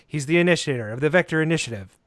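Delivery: podcast host intro. Acted. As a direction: welcome listeners like a radio host.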